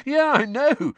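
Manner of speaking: high, loud, gleeful agreement; amused excitement. Acted. Ron sharing startling gossip, amused and excited. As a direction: pitch it up as if about to laugh. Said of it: "as he started to laugh".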